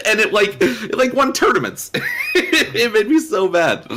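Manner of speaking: high-pitched, laughing through the words; helpless giggly exasperation. Real, not acted. Laughing through a remembered gripe.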